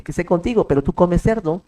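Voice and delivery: normal talking voice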